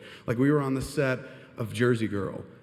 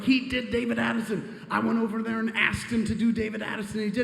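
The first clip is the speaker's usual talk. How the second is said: much higher, laughing boast; giddy excitement; 11 semitones higher than usual. Retold or imitated. Telling his friend, giddy and high. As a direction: gush like a giddy fan. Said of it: "I was like".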